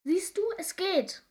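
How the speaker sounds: high, loud gleeful shouting; piercing, excited creature cry; shrill cry. A narrator's reading. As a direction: call it out shrilly as if flying along. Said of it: "schrie es schrill".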